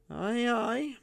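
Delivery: put-on quick, high, nervous old man; high, hurried old miser. A narrator's reading. Miser hurriedly agreeing with Kane, high and nervous. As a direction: rush it out high and jittery. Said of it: "muttered old Ezra hurriedly".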